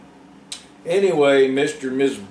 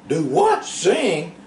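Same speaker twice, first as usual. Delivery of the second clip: surprised; 10 semitones higher, much slower than usual. A narrator's reading. Favor surprised by request.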